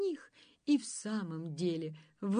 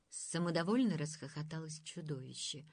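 usual speaking voice

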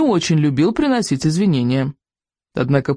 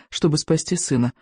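ordinary delivery, nothing marked

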